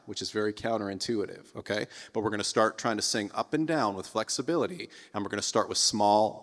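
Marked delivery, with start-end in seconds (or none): none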